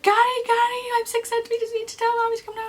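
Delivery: high, loud, urgent wail; frantic plea. Retold or imitated. In labour ringing stepdad to fetch mother, frantic high plea. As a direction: cry out for help, very high pitched.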